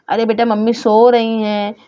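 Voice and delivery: ordinary delivery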